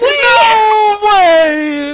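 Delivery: high, loud stunned scream. Real, not acted. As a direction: scream in disbelief at the play. Co-caster screams at clutch.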